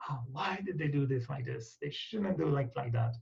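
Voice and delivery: soft, grumbling inner voice; muttered complaint. Retold or imitated. Judging other people in his head, quietly grumbling. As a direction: complain under your breath. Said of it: "negative thoughts for other people".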